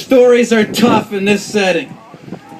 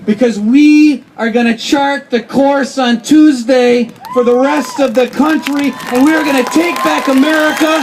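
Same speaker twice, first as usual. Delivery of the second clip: rally speech; 4 semitones higher than usual. Real, not acted. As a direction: build to a roaring campaign finish. Rally climax building to shout.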